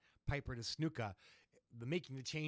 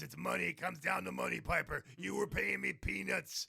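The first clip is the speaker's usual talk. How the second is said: rough cowboy growl, higher; gravelly voice; 5 semitones higher, 3 dB louder than usual. Retold or imitated. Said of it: "he's got that gravelly voice".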